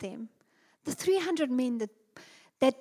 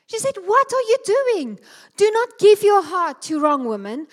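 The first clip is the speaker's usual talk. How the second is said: high, loud, scolding mother's voice; 7 semitones higher, 7 dB louder than usual. Retold or imitated. Preacher voicing the king's mother rebuking her son, loud and high. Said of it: "I know when a mother speaks three times".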